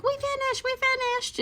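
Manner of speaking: put-on very high little-kid squeal; excited kids. Kids to the prize vendor, excited kids imitation. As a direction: imitate children announcing it, way up high.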